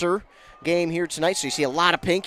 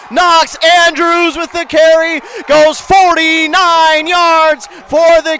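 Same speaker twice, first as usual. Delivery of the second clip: long stretched loud shout; touchdown call; 14 dB louder, much slower than usual. Real, not acted.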